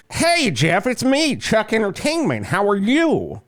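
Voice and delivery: chirpy mascot voice saying hello to Jeff; a light put-on friendly pitchman; Chuck Entertainment role play. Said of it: "let's role play. I'm gonna be Chuck Entertainment".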